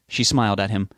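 plain reading voice

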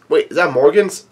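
plain reading voice

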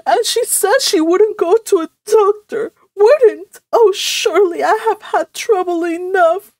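high, loud, woeful wail; moaning. A narrator's reading. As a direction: wail it like a long-suffering mother. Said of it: "moaned Missus Frederick".

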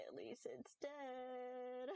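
slow, with the last word stretched out; reluctant approval. Retold or imitated. Streamer when cats bring her dead roaches, drawn-out reluctant approval. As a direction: drag it out as a grudging consolation. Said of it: "I'm just like".

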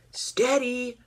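high call, held out; shout. A narrator's reading. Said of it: "shouted Duck".

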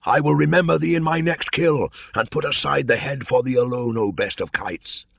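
1 crying out. Acted. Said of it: "cried Bagheera".

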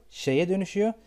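ordinary delivery

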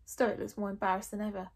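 plain reading voice

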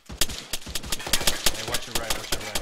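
urgent loud callout; shouted warning. Real, not acted. Warning teammate in firefight.

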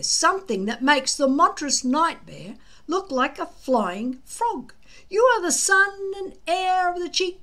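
put-on a keen young Viking talking up a wonder dragon; Fishlegs' excited voice.

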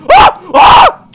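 two short, high, loud yelps; startled shrieks. Real, not acted. Player gets scared mid-game, two startled shrieks. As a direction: yelp twice in alarm.

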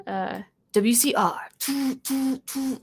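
breathy toot toot jingle trailing off unvoiced; radio jingle imitation. Shown as a demonstration. Showing viewers radio music, mouthed jingle. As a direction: hum a little station ident. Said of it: "like that".

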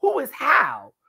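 high indignant ranting; mock angry mom. Mom and clerk arguing, high angry retort. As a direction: rant back high and offended. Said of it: "The mom's like".

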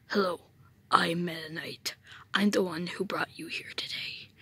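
low, hushed whisper of a mysterious knight; Meta Knight. Acted. Whispered villain character voice.

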